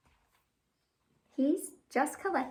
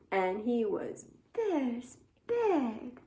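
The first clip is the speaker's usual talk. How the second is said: very slow; much slower than usual. Acted. Giant worm, dragged out slowly.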